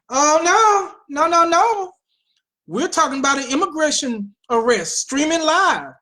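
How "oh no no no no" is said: higher, emphatic teasing no; playful, raised-pitch correction; mock denial. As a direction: knock down your own question with put-on dismay. Answering own mock questions.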